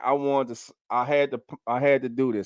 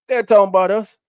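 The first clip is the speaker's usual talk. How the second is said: rallying cry; 6 semitones higher, 6 dB louder, faster than usual. Real, not acted. Rallying fans against the critics.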